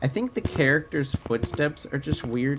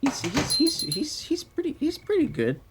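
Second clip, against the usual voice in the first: squeaky high voice. Pretend. Squeaky high voice mid-chat.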